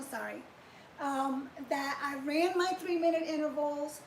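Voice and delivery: ordinary talk, nothing marked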